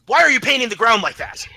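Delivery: loud, high shout of accusation; sharp scolding. Acted. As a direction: demand an explanation at full volume. Computer scolds player's character.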